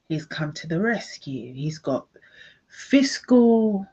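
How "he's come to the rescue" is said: ordinary delivery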